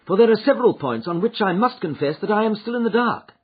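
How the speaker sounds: a hint of keen Holmes. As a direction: press her to talk. Holmes questions Mrs Toller.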